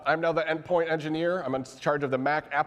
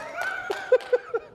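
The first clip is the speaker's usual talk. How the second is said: light laugh. Real, not acted. Laughs at management joke.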